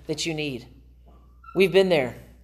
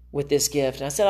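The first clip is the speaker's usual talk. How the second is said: normal talking voice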